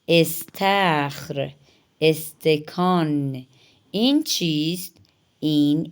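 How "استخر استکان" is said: استخر and استکان stretched slowly, e-sound at the start; slow model words. Shown as a demonstration. Teacher pronounces استخر and استکان slowly for students. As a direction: spell out the opening ای of استخر, استکان. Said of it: "الف زیر ای آتا ہے".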